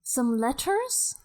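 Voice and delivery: light Scottish lilt; Scottish accent. Acted. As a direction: keep a mild Scottish accent. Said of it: "this is a very Scottish Beth".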